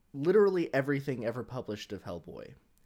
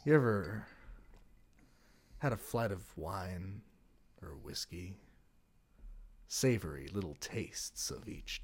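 sultry ASMR ad read; 3 semitones lower than usual. Pretend. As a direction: purr the ad like a seductive late-night spot. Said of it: "the best A S M R I've ever heard".

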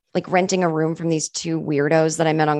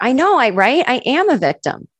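raised, eager self-pity; naive agreement; 6 semitones higher than usual. Retold or imitated. Agreeing eagerly with the ex's sister, raised. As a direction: happily agree you're a victim. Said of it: "I said to her".

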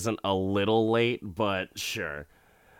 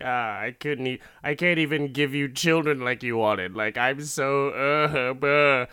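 higher, hammed-up blubbering husband; mock crying; 4 semitones higher, 3 dB louder than usual. Husband cries on wife's shoulder over children, mock crying. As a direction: break down into fake sobs, louder at the end. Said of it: "he's crying on her shoulder".

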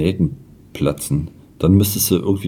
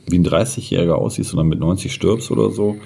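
ordinary delivery

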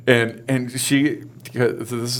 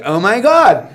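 high-pitched fake amazement; mock gushing; 8 semitones higher, 4 dB louder than usual. Husband mimicking the endless workplace chat, mock amazement. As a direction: parody an overexcited reaction. Acting gushy reply.

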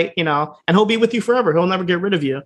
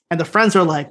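usual speaking voice

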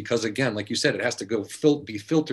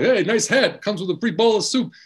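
higher, playful goofball voice; goofball Rodney bit; 7 semitones higher than usual. Retold or imitated. Retelling Rodney's scene, playful goofball voice. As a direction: ham it up like a clowning comic.

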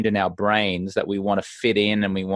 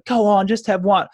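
higher coaxing push; pushy host; 9 semitones higher than usual. Retold or imitated. Host pushing the hesitant guest again, higher coaxing. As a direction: nudge them to drink, higher. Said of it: "then they go".

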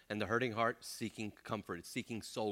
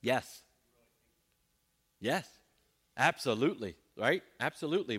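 usual speaking voice

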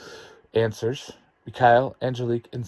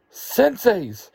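high, loud cry of greeting; excited shout; 10 semitones higher, 5 dB louder than usual. A narrator's reading. Students greeting their senseis in unison, excited shout. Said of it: "shout in unison".

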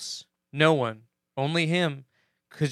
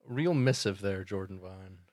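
sarcastic mock awe; 7 semitones lower, 9 dB quieter than usual. Mocking commenter's grand statement.

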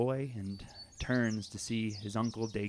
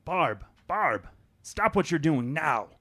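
loud, high, urgent plea; desperate father; 6 semitones higher, 7 dB louder than usual. Acted. Father grabs Barb's arms begging her to stop, loud urgent plea. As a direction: grab her and beg her to stop.

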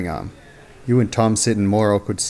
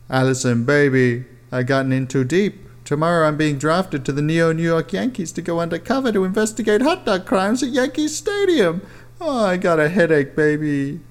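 whiny; 8 semitones higher than usual. Acted. Protagonist whines to girlfriend.

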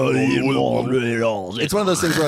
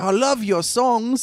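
put-on much higher, excited little kid; eager orphan kid; 10 semitones higher, slower than usual. Orphan kid gushing to Amadeus, high and eager. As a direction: gush like a fan.

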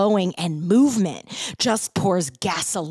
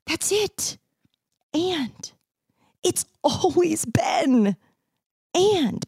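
awed revelation; 8 semitones higher, slower than usual. Retold or imitated. Dramatic revelation, far, shout.

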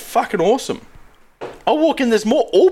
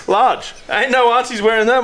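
ordinary talk

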